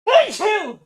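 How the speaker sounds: high excited yell; excited shout. Real, not acted. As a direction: blurt it out loud. Sudden reaction to chat.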